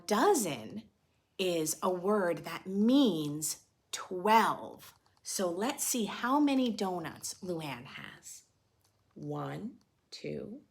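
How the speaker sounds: ordinary delivery, nothing marked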